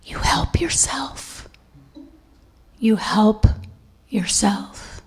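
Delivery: soft unvoiced whisper of you help yourself; the angel leans to my ear and whispers. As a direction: breathe it softly like an angel at the ear. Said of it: "bent down to my ear".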